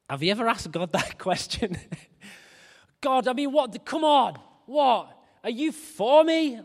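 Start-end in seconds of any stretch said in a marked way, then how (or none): 3.0-6.6 exasperated plea. Acted. Frustrated prayer to God acted.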